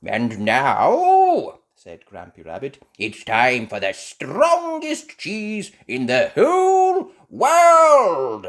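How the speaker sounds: loud, slow, rising to a big finish; grand announcement. Acted. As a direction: build up the drama. Grampy announces strongest cheese.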